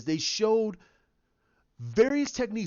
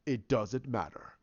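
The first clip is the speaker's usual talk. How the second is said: Vader's reply; 7 semitones lower, 5 dB quieter than usual. Retold or imitated. Vader answers coldly.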